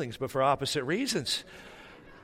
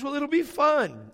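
much higher, drawn-out imitation of wife; coaxing enthusiasm; 13 semitones higher, 4 dB louder, slower than usual. Wife coaxes husband about meeting, high drawn-out enthusiasm. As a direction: sell it high and slow, stretching "fun".

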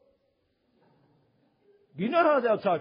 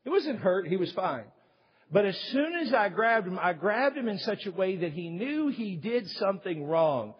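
normal talking voice

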